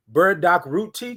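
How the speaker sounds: usual speaking voice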